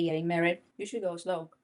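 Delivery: ordinary delivery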